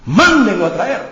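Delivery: high, loud order; David orders his servants, high and loud; royal command. Acted. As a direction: bark it out like a king. King gives an order.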